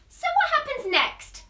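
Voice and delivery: high, bright questioning; eager question. Acted. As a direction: ask with wide eyes. Kipper asks eagerly.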